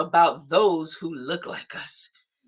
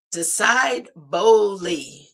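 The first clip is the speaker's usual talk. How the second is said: slow emphatic; 2 semitones higher, 5 dB louder, much slower than usual. Shown as a demonstration. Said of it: "closing motto".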